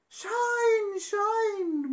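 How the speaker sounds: high bright chime; sung sunshine. Acted. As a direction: chime like the morning sun. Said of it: "went the sun".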